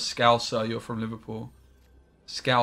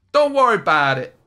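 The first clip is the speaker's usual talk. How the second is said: loud, higher American accent attempt; Dara; 6 semitones higher, 10 dB louder than usual. Shown as a demonstration. Streamer asked for American, loud impression in American accent. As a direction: punch it out in a put-on American accent. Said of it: "like, a Dara impression".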